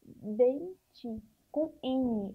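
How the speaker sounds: "dente com N" drawn out very slowly; very slow dictation. Shown as a demonstration. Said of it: "Ó".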